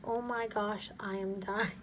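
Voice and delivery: ordinary delivery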